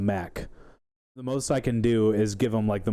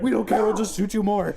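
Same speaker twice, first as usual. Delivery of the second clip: mocking players; 10 semitones higher than usual. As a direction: taunt the villain. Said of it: "And like".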